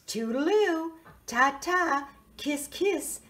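a hint of a gushing aunt; lightly voiced auntie. Auntie saying goodbye.

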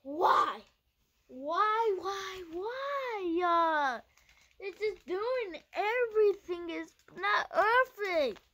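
high, loud whiny wails; wailing why. Real, not acted. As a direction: keep crying out why, slowly at the end. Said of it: "just screaming and laughing".

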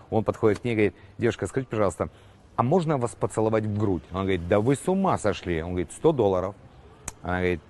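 quiet, low joke telling; hushed joke telling. A narrator's reading. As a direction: tell it under your breath. Whole joke told quietly.

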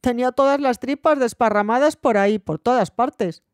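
a slowed, relished ending; bold relish. A narrator's reading. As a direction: savour the gory detail boldly. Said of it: "Dijo con atrevimiento".

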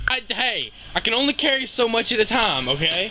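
normal talking voice

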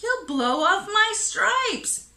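high, loud cartoon yelp; zebra's yell. A narrator's reading. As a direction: cry out in alarm as a zebra. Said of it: "The zebra yelled".

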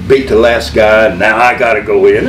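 ordinary delivery

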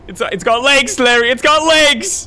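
rising high, loud panicked cry; alarmed. Real, not acted. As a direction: panic building as it repeats. Scared by monster.

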